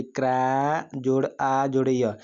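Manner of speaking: क्रा, आ, य each held slowly and separately; stretched, piece-by-piece क्रा जोड़ आ जोड़ य; broken into sounds. Shown as a demonstration. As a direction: slowly take क्राई apart as क्रा, आ, य. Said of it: "क्राई को आप तोड़िए".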